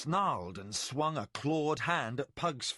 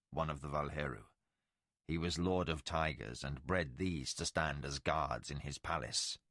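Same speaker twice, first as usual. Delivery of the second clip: much lower, softer, solemn voice; ancient Valheru lord; 10 semitones lower, 4 dB quieter than usual. Acted. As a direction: speak as a legend come to life. Tomas explains to Pug.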